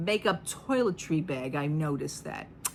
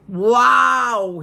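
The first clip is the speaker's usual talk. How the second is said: loud wow; 6 semitones higher, 12 dB louder than usual. Retold or imitated. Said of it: "openly, outright".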